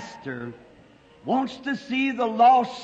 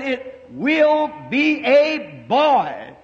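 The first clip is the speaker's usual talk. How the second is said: slow, deliberate, certain reply; each word laid down firmly and slowly; firm assurance; 3 semitones higher, 4 dB louder, much slower than usual. Retold or imitated. Preacher assures the doubting mother, slow and certain.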